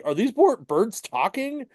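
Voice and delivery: high-pitched mock freak-out of a kid. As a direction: imitate a twelve-year-old in disbelief, voice jumping up. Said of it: "freaking out 'cause he's twelve".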